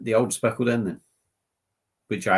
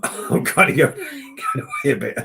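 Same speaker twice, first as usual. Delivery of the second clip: laughing; 3 semitones higher, 4 dB louder than usual. Real, not acted. Laughs at his own drifting.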